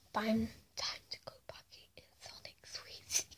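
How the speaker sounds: very soft, barely voiced whisper; hushed little scheming aside; secretive whisper. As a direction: whisper it as if to yourself. Toy character speaks secretly to itself.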